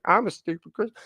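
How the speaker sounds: high, goofy clown voice. Retold or imitated. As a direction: play the fool mockingly. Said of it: "put on, like, a clown makeup".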